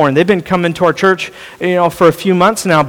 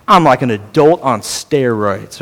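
low, slow mock bragging; lightly voiced cocky twenty-something; bragging young adult; 7 semitones lower than usual. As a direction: lean on it like a cocky kid. Twenty-one year old bragging.

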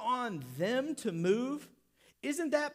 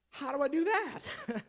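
slightly comic, higher question; lightly voiced puzzled man; 5 semitones higher than usual. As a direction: ask it as a bemused man, lightly hammed-up. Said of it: "kinda looked at him and said".

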